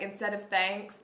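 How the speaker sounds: ordinary talk, nothing marked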